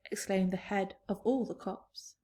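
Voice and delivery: usual speaking voice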